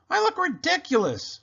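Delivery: put-on high embarrassed girl voice; embarrassed Muffet. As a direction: wail at looking silly.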